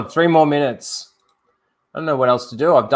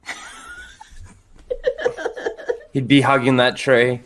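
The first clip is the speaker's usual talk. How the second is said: ordinary talk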